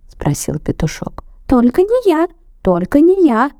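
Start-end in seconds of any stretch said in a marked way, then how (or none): 1.5-3.5 high squeaky shout; first mouse refusing loudly. A narrator's reading. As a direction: cry out not me. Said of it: "mice refusing".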